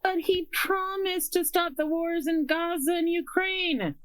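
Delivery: high squeaky mocking voice. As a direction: squeak it out like a naive voter. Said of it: "I love the squeaky voice you used there".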